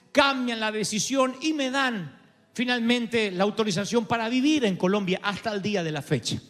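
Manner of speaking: ordinary talk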